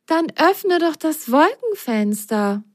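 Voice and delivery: cheerful suggestion. A narrator's reading. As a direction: suggest it happily. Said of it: "schlug Biene fröhlich vor".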